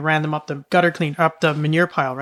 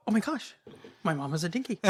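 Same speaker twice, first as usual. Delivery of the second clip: much softer, as if to oneself; imitated hushed dismay; 12 dB quieter than usual. Child alone in barn absorbs misheard news, soft mock dismay. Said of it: "I go".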